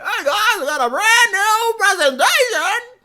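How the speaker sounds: very high, loud, stretched-out presenter voice; hammed-up host announcing with absurdly drawn-out words; presentatore caricaturale. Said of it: "somebody like that".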